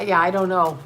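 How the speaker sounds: ordinary talk, nothing marked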